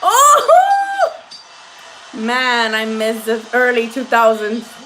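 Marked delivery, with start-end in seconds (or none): 0.0-1.2 "Oh!" yelled high and loud at a big punch; real startled yell at the screen; a burst of surprise; sudden shout. Real, not acted. Watching a punch land.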